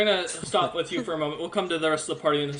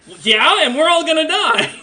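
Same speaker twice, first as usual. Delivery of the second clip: very high, loud doomed outburst; hammed-up panic, pitch shooting up; panicked kid; 11 semitones higher, 10 dB louder than usual. Kid telling the party they are doomed, loud high panic. Kid protesting as doom closes in.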